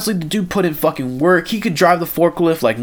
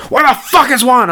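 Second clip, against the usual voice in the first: mock yell, higher; 9 semitones higher, 4 dB louder than usual. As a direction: boss yelling a question. Dave yells at him directly.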